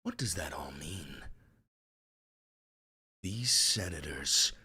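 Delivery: theatrical reading, swelling to a shout then sinking to a whisper; dramatic reading. Shown as a demonstration. Streamer reads Trump's post as a dramatic performance. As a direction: read it like a grand dramatic monologue. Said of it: "I wanna do a dramatic reading".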